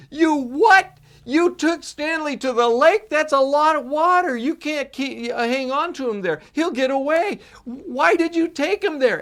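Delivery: put-on high, loud furious child's yell; angry shout. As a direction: scream it at Dad in outrage. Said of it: "Richie was so angry, so upset".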